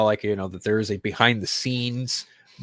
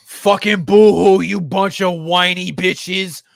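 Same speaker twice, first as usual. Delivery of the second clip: mocking taunt; 6 semitones higher, 10 dB louder, slower than usual. Real, not acted. Mocking whiny fans.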